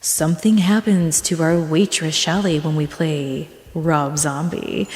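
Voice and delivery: low, measured DJ announcer; DJ announcement. Retold or imitated. DJ teasing a strip club waitress's act, announcer voice. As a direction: draw it out like a club DJ introducing an act. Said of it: "What the D J would do, he goes".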